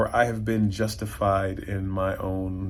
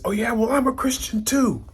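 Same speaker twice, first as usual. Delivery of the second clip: sarcastic retort; 13 semitones higher, 3 dB louder than usual. Retold or imitated.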